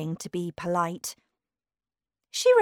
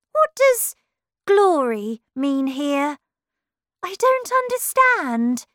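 very high-pitched, louder character voice; confused Alice; 9 semitones higher, 8 dB louder than usual. Acted. Alice not understanding Humpty's word, confused and very high. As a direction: ask in high, baffled pitch.

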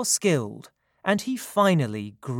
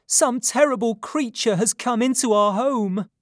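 high, urgent raised voice; loud alarmed cry; 7 semitones higher, 5 dB louder than usual. A narrator's reading. Mother warning of a creature in the home, crying loudly. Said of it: "she cried loudly".

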